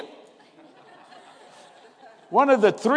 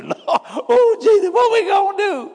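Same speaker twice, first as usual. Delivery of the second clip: mock panic; 8 semitones higher than usual. Mocking panicked congregation.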